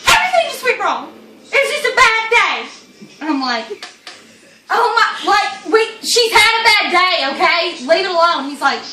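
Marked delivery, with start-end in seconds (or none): 0.1-2.7 high, loud, overwrought lament; mock wailing. Friend wailing to the cop. 4.7-8.5 loud, high protest; retold exasperation. Teller defends friend to cop.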